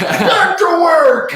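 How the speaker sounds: high, slow shouted order; gruff boss shout. Pretend. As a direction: bark it like a foreman. Boss calling out.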